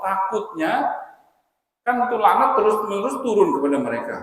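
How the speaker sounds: loud, high-pitched preaching in emphatic bursts; forceful preaching. Real, not acted. Preacher warning listeners off cursed places, loud forceful preaching. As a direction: drive the warning home at full force.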